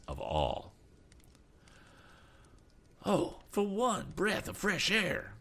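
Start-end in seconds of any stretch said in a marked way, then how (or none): 3.0-5.3 slow, high-pitched wail; suffering cry, pitched very high; anguished cry. A narrator's reading. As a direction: wail it desperately, drawn out. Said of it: "cried Mister Damon".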